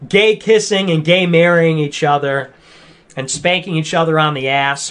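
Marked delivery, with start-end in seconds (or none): none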